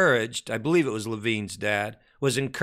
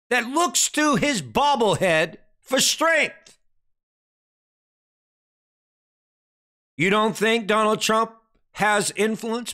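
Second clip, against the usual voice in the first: high, ringing preacher voice, pushing every phrase; full-force preaching; 8 semitones higher than usual. Acted. Preacher rousing the congregation about Trump's influence, full-force preaching. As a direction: preach it up to the rafters.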